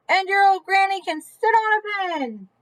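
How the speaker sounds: lightly voiced pig yelling a taunt; yelled insult. A narrator's reading. Pig yelling an insult at the wolf, mock. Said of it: "the third little pig yelled".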